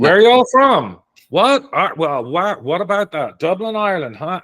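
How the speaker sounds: high, loud eager small-town chatter; chatty Midwesterner. Retold or imitated. Irish guest imitating a curious Midwest clerk, high and loud. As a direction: mimic the nosy shop clerk, high and loud. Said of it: "Midwesterner quizzing him".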